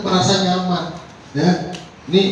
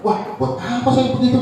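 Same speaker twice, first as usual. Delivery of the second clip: mildly affronted, higher-pitched retort; offended; 8 semitones higher, 4 dB quieter than usual. Acted. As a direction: act a touch insulted by the offer. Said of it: "Wah, tersinggung dia".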